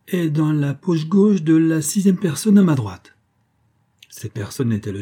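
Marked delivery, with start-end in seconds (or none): 0.1-3.2 high, loud announcement; exclaiming. A narrator's reading. Magician names the pocket before the guests, exclaiming. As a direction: proclaim the reveal to the room. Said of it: "s'écria-t-il".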